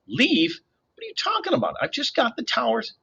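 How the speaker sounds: high, irritated disbelief; annoyed girl. A narrator's reading. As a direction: brush him off, high and annoyed. Annabeth annoyed, distracted.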